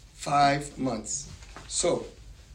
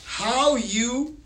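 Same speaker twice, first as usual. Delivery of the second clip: higher-pitched, proclaiming Bible verse; declamatory scripture reading; 5 semitones higher, 4 dB louder, much slower than usual. A narrator's reading. Reads Isaiah aloud.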